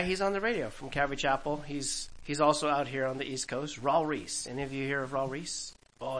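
ordinary delivery

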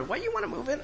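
high mocking disbelief; scornful attitude. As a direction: play the snooty lady scoffing. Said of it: "she kinda had that attitude".

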